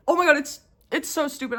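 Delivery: words pushed past a burp; holding back a burp. Real, not acted. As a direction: talk around a rising burp. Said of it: "I'm sorry I burped".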